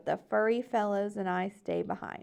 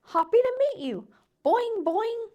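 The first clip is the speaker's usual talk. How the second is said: high, loud bouncy cheer; fabulous froggy greeting; 12 semitones higher, 7 dB louder than usual. A narrator's reading. Frog leaping toward new neighbor, high loud bouncy greeting. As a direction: greet him with a big leaping boing. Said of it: "in my fabulous froggy way".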